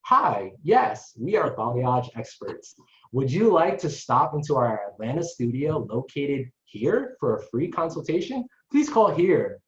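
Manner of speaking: front-desk greeting, lifted and lilting; phone voice. Acted. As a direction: answer like a salon receptionist on the phone. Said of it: "I really like your phone voice".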